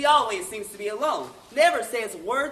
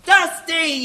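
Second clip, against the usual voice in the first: high, loud holler for someone missing; calling out; 6 semitones higher, 10 dB louder than usual. Acted. Announcer calling missing reader, loud impatient holler. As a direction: holler the name to fetch him.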